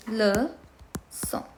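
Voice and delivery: "Leçon" broken as le, pause, son; teacher sounding out "Leçon"; syllable by syllable. Shown as a demonstration. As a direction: slowly separate both syllables of "Leçon". Teacher breaks word into syllables.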